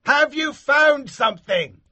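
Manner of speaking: high, loud call down a well; shouted. A narrator's reading. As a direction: shout down, eager. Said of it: "shouted the man".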